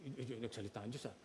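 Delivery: quick, soft startled babble; mock fright. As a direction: act spooked by a voice in the dark. Scared person hearing God speak.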